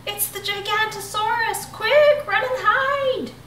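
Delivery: high, loud panicked warning; frantic cry. Acted. Bonehead crying out a false alarm, high and loud. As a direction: shout the alarm to his friends. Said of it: "Bonehead cried".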